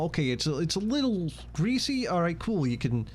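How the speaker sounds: high-pitched attempt at a New York accent; wobbly New York accent. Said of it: "I can't fucking do my accent right now".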